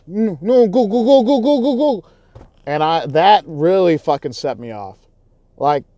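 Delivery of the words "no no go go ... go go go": high, loud, dismissive "go, go, go" brushing him off; mocking the other driver. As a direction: wave him on, higher and louder, half laughing. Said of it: "This is what I saw".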